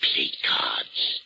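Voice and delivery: slow, low, ominous words; low and deliberate, for suspense; ominous revelation. Acted. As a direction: let each word fall slowly. Ominous revelation about wax dummies.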